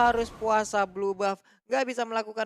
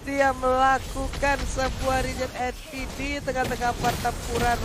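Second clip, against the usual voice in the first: esports caster; 4 semitones higher, 4 dB louder, slower than usual. Real, not acted. Co-caster hyped play-by-play.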